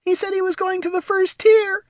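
put-on very high, small child voice; little pup voice. A narrator's reading. Pup Terry confessing to mother, trembling small voice. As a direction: squeak it out like a scared pup.

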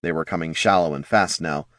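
usual speaking voice

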